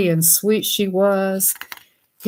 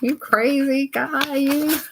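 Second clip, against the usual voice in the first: playful teasing; 7 semitones higher than usual. Real, not acted. Teasing book character.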